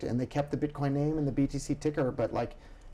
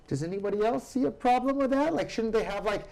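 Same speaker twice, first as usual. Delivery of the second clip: high, loud incredulous rant; indignant rant; 7 semitones higher, 3 dB louder than usual. Real, not acted. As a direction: rant at the absurdity, building. Political rant, rising indignation.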